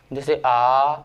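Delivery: आ drawn out over three beats; teacher sustaining आ as a pluta vowel; prolonged vowel demo. Shown as a demonstration. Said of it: "तीन मात्रा का या बहुत ज़्यादा समय लगता".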